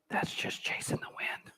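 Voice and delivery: soft whispered reply. Shown as a demonstration. Pastor whispering back to the inner voice, hushed. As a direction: whisper it back to the voice in your head. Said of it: "I always whisper back".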